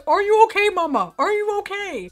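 high, freaking-out voice for Dante; worried pet voice. Acted. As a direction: voice a fretting pet checking on mama. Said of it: "Dante's, like, freaking out".

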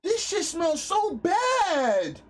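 high, loud disgusted cry; disgusted yelling. Real, not acted. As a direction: recoil from the stench, loudly. Disgusted reaction to the smell.

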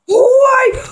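high loud anguished why; frustrated shout. Real, not acted. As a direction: cry out at the screen in despair. Frustrated at the game.

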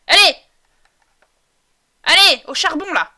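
loud, high command; urging shout. Pretend. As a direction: shout them off to work. Ordering the Sims to work.

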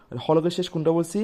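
ordinary delivery

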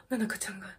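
gasp, then whisper her name in awe; breathless hushed whisper, almost no voice; awed gasping whisper. Real, not acted. Fan watching the music video gasps and whispers in awe. Stunned by member on screen.

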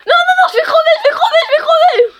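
high, loud, laughing cries; laughing panic. Real, not acted. Being caught, laughing.

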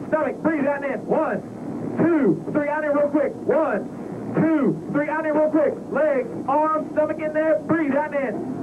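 very high, urgent cadence callouts; intense breathing coach. Real, not acted. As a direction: drive him hard through each breath. Instructor calls breathing during six G run.

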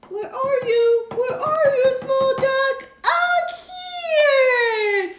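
sing-song high calling; tiny high voice. Acted. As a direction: call out like a tiny dog. Small dog voice for child.

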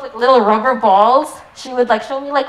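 ordinary talk, nothing marked

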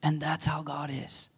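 whispered aside. Real, not acted. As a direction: drop to a whisper for the point. Unvoiced aside.

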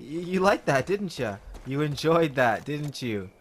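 normal talking voice

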